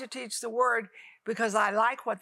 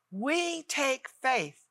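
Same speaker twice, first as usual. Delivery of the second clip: prayer; 2 semitones higher, slower than usual. Acted. Televangelist praying over her viewers, hushed and slow. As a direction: pray it gently over the viewers.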